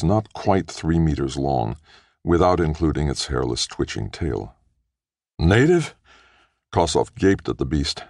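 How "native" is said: surprised. Acted. Kossoff sees an unknown beast.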